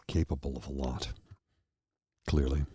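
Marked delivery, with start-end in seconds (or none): none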